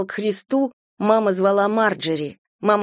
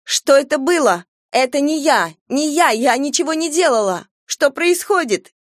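high panicked shouting, voice climbing; frightened outcry; 7 semitones higher than usual. Acted. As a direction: cry out in terror, denying blame. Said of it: "Марджери выкрикнула".

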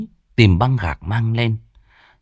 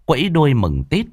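plain reading voice

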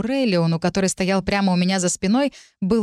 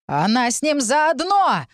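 high, drawn-out accusing shout; accusing cry; 6 semitones higher, slower than usual. A narrator's reading. Woman denouncing the girl to the crowd, crying out. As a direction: cry out slowly, pitch climbing. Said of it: "закричала какая-то женщина".

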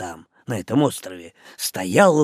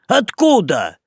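higher loud yell; 7 semitones higher, 5 dB louder than usual. A narrator's reading. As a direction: shout the question. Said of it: "они орут".

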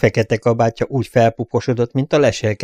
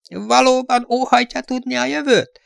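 eerie fortune-teller. A narrator's reading.

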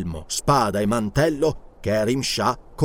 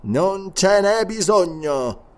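slow sorcerer voice; 5 semitones higher, much slower than usual. Acted. Mesmerist answers calmly.

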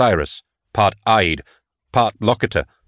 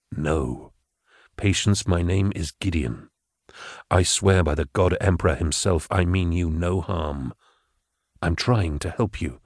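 Gideon's psychic voice; 2 semitones lower, 4 dB quieter than usual. Acted. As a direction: speak gently into her mind, calming.

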